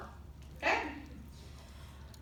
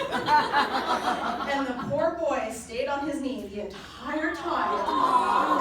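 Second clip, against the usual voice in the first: slow words stretched by real laughter; laughing slowly; 6 dB louder, much slower than usual. Real, not acted. Telling the audience about his long kneel, laughing. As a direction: let the laugh drag the words out.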